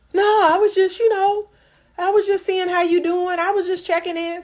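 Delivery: hammed-up sheepish man checking in; sheepish guy excuse. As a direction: play the man caught acting casual. Man's sheepish reply, voiced by her.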